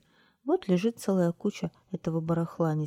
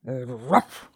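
happy dog bark; 3 semitones lower, 5 dB louder than usual. A narrator's reading. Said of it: "радостно сказал пёс".

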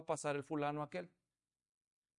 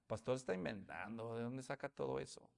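skeptical listener; 8 semitones lower, 14 dB quieter than usual. Retold or imitated. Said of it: "algunos de ustedes están diciendo".

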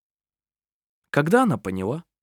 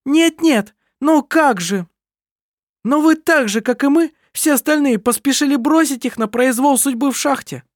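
heated accusation; 13 semitones higher, 5 dB louder than usual. A narrator's reading. Heated family quarrel.

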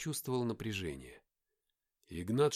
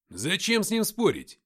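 loud, high-pitched protest; indignant; 7 semitones higher, 10 dB louder than usual. A narrator's reading. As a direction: protest hotly with raised voice. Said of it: "возмутился Алексей". (громко и возмущённо)

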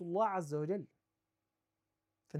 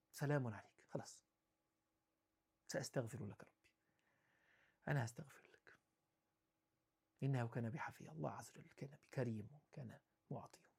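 much softer and lower, almost a murmur; hushed reflection; 7 semitones lower, 14 dB quieter than usual. Real, not acted. Preacher softly repeating Abraham's gentle farewell, low. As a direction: let it sink into a hush.

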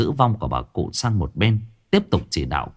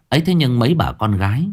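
plain reading voice

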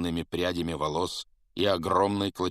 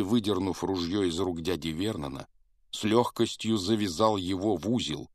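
ordinary delivery

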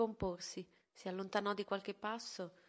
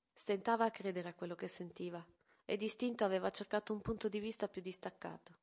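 plain reading voice

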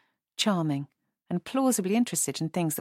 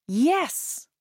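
high, louder burst of yes; eager shout; 9 semitones higher, 4 dB louder than usual. A narrator's reading. Woman accepting Michael's offer, eager shout. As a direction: call it out with grateful eagerness. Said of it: "she shouted".

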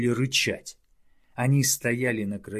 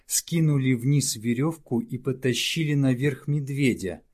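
usual speaking voice

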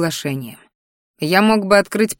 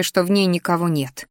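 plain reading voice

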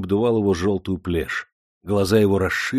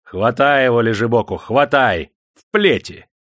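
raised, urgent command; barked order; 3 semitones higher, 5 dB louder than usual. A narrator's reading. Master sends lazy lad to be flogged, commanding. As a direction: order the servants to seize him.

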